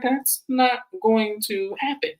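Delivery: high, drawn-out refusal in her voice; slow, high mock refusal; imitating Stacy. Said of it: "And Stacy was like".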